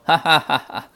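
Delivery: high, loud acted "ha ha ha ha"; storybook belly laugh at a foe; mocking laughter. A narrator's reading. Sun Wukong laughs at the slapped demon king, mockingly. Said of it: "笑道说".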